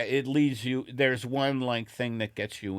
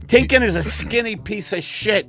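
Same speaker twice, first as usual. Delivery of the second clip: loud, higher, punchy host pitch; podcast ad read; 5 semitones higher, 8 dB louder than usual. Acted. Host pitching the sponsor's T-shirts to listeners, loud emphatic ad read. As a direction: sell it big to the listeners.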